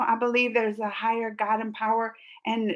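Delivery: usual speaking voice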